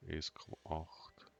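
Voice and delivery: normal talking voice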